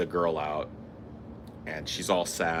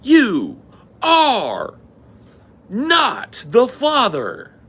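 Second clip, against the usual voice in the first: Maury reveal; 9 dB louder, much slower than usual. Retold or imitated. Reenacts Maury verdict.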